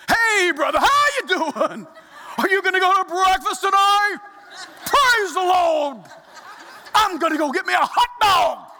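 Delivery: loud, high, hammed-up bellow; mock preacher shout. Shown as a demonstration. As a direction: boom everyday small talk like a revival preacher. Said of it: "None of us talk to one another like this".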